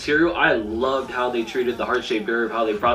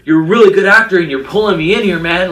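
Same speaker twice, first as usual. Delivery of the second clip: louder, fast, gushing praise; louder, quick, awestruck admiration; excited gushing; 4 semitones higher, 9 dB louder than usual. Retold or imitated. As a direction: blurt it out like a thrilled fan. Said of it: "I'm like".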